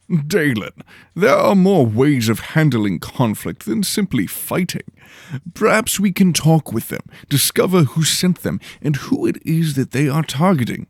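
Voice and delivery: pompous lord calmly explaining; Lord Henley, lofty. Acted. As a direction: lecture your apprentice grandly.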